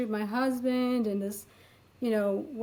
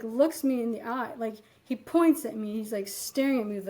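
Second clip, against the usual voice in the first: usual speaking voice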